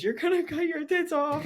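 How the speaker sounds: high-pitched imitation of a tearful wail; mock crying. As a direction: squeal it like a weeping girlfriend, hammed up. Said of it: "She just starts crying and goes".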